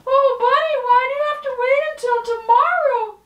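high, fast, bubbly puppet voice; high puppet girl. Acted. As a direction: chirp it like a perky little puppet.

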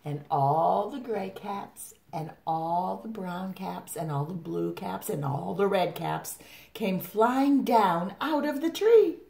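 excited storyteller's climax; animated story reading. A narrator's reading. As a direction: stretch each ALL, then lift the ending. Reads story to children.

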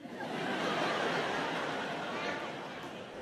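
quiet unvoiced laugh at own joke; breathy laugh. Real, not acted. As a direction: chuckle silently through the breath.

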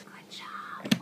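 soft whispered praise. Real, not acted. Encouraging baby quietly.

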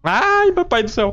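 high, loud, slow scared wail; terrified cry. Real, not acted. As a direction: cry out in real fright. Monster appears in game.

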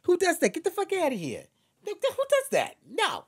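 loud, high, incredulous Westchester accent. Shown as a demonstration. Performer mimicking Westchester locals, shrieky disbelief in Westchester accent. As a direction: climb into mock-outraged Westchester squeals. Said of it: "they say things like".

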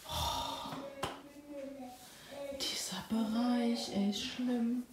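leise geflüstert, langsam; flüstere es entsetzt. Real, not acted. Betrachtet enge Hose. (flüsternd)

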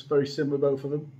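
glass rim at lips; talking into wine glass; speaking while sipping. Real, not acted. Seen 2: Rim at lips from 0.6s while still speaking the words.

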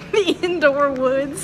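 slow words through laughter; laughing. Real, not acted. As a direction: giggle the words out slowly. Joking about the indoor woods.

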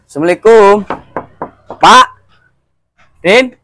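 calling loudly toward the house; a loud call at a shut door. Acted. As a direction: raise the voice to reach anyone inside.

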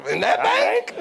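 loud, high-pitched comic disbelief; incredulous outburst. Retold or imitated. Retelling his answer to the failed bank's owner, incredulous outburst. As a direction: blurt it out as an appalled retort. Mock outrage at failed bank offer.

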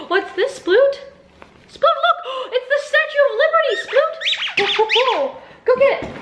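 thrilled squeals to the puppy. Real, not acted. Owner showing the Statue of Liberty toy, gasping excited coo.

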